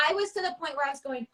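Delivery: usual speaking voice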